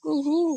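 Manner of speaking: imitating a cuckoo's kuhu call. Shown as a demonstration. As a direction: sing out kuhu like the bird. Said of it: "मग?".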